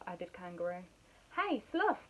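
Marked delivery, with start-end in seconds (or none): 1.4-2.1 light, higher-pitched call. A narrator's reading. Monkey to dozing Sloth, calling out. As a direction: call out to get attention. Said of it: "called Monkey".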